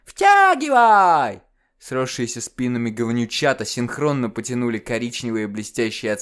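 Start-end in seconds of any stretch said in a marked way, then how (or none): 0.0-1.4 очень высоко и громко, тянуто; протяни команду как ребёнок; протяжный детский приказ. Acted. Близнецы командуют тварью. (детский протяжный приказ)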